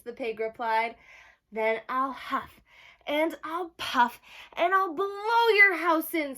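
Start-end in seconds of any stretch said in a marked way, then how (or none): 1.5-6.4 wolf screaming. Acted. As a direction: build to a full scream. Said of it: "screamed the wolf".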